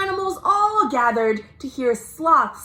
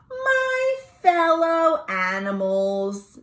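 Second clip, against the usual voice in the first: slow, higher, speech-like opening; lightly voiced sloth addressing the crowd; Sloth's voice; 7 semitones higher, slower than usual. Sloth addressing the animals, slow and lofty.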